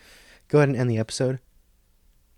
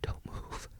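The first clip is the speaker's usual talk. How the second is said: tense whisper; barely audible cautioning whisper; hushed warning; 17 dB quieter than usual. Acted. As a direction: whisper it, frozen in place. Warning a child near predator.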